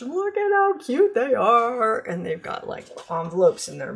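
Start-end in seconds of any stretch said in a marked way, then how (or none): 0.0-2.0 high, louder coo over something adorable; gushing delight. Real, not acted. Crafter showing viewers cute dog paper, gushing. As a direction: gush in a raised, cooing voice.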